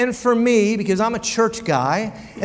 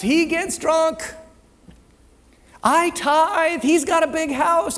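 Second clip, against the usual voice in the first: smug comparison; 6 semitones higher, slower than usual. Pretend. Mocking self-righteous comparison list.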